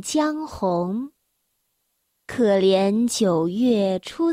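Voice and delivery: slow, drawn-out classical recital; poem recitation. Acted. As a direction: chant each line slowly. Reciting Tang poem slowly.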